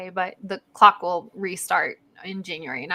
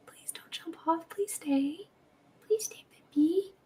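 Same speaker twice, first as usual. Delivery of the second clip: soft, higher whispered begging; pleading whisper; 7 semitones higher, 8 dB quieter, slower than usual. Real, not acted. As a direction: hush and beg the pet not to leave. Begging the cat to stay.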